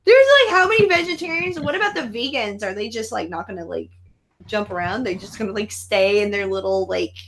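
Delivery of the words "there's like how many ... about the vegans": high, loud indignant outburst; reacting to a silly zombie movie, loud and high; mock outrage. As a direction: blow up in a shrill rant. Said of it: "And I was like".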